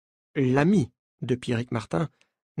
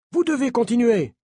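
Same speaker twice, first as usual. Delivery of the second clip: aggressive; 9 semitones higher, 4 dB louder than usual. A narrator's reading. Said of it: "Deux sont très agressifs".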